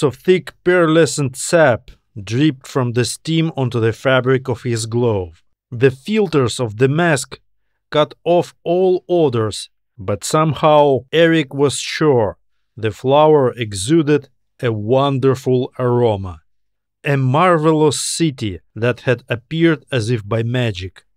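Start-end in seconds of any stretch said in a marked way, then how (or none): none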